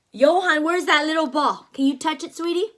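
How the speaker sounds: ordinary delivery, nothing marked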